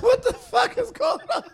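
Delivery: high shocked cry through laughter. Retold or imitated. Seeing the man reappear, a laughing shocked squeal. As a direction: squeal it in disbelief. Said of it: "I'm like".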